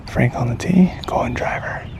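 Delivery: hushed golf commentary whisper. Real, not acted. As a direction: whisper so the golfers behind can't hear. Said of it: "So we're gonna keep it a little bit quiet here".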